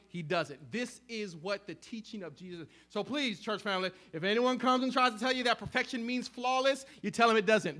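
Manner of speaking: usual speaking voice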